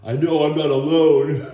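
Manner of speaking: hammed-up loud bedside vow; loud Rocky line. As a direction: belt it out in mock drama. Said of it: "He's like".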